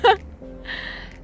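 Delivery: high laugh, trailing away; real giggle. Real, not acted. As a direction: giggle after fluffing a greeting. Laughs after greeting. (высокий смешок)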